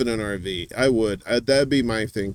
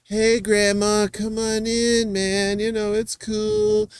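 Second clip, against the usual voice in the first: stoned raver; 9 semitones higher than usual. Retold or imitated. Playing the ravers greeting the old lady, high and dreamy. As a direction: welcome her in like a blissed-out raver. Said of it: "everybody would just like".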